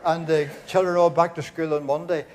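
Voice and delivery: ordinary talk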